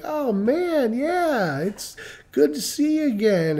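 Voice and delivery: high, put-on delight; feigned recognition. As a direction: pretend you're thrilled to see someone you can't place. Faking recognition like everybody else.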